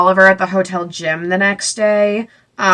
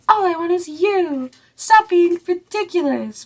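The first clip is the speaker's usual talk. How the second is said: mock swoony boyfriend; 10 semitones higher than usual. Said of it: "he's once again just like".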